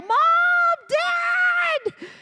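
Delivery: put-on high frightened kid shout; a terrified child calling for help; scared child yelling. Scared child calls parents.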